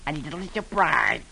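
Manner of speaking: soft, put-on cartoon duck voice; lightly voiced duck uncle, hushed; Donald Duck. A narrator's reading. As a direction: reveal it quietly in character. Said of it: "and Donald says".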